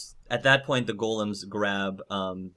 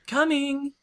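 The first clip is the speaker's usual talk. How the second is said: high, louder sing-out; calling out; 5 dB louder than usual. Acted. Dagna answering a pounded door, high call. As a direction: call brightly to someone at the door.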